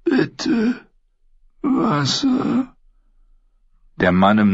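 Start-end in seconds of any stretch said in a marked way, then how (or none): 0.0-2.7 high, rasping plea of a parched prisoner; a high broken croak begging for water. A narrator's reading. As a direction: croak it out, throat dry as dust. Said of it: "krächzte er".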